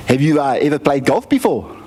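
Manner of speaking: put-on South African golf coach; South African accent. Coach asks boy about golf, mock South African accent. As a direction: question the pupil in an imitated South African accent. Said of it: "I've never heard this accent before".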